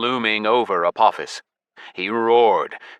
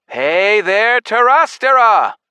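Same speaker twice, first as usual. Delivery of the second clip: much higher, loud call; shouting; 11 semitones higher, 8 dB louder, slower than usual. Acted. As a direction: call out across the world. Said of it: "I shouted".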